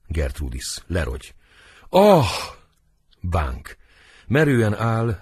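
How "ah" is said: high, loud gasping "ah"; theatrical death cry; acted cry of pain. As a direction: cry out as if stabbed. Stabbed queen collapses.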